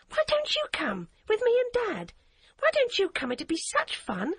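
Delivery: put-on a girl begging a friend to come along; begging little girl. A narrator's reading. Child voice of Hadia.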